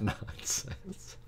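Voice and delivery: normal talking voice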